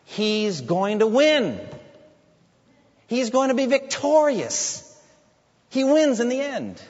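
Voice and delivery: loud, high, ringing declaration; triumphant proclamation. Real, not acted. Preacher declares Christ's final victory to the congregation, loud and triumphant. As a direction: proclaim victory with full conviction.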